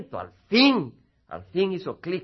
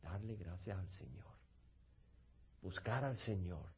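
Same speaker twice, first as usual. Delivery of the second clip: soft reflective close; 6 semitones lower, 11 dB quieter than usual. Acted. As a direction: gently urge the congregation inward. Cierre suave y reflexivo del sermón. (exhortación suave y baja)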